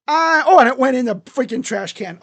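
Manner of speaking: loud, high burst of amazement; real excited outburst; excited yell. Real, not acted. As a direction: shout it out in sudden surprise. Reacts to his lucky throw.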